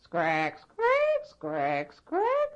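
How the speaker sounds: put-on see-saw squeak of an old rocking chair; rocking chair creak. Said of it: "And so when she rocked".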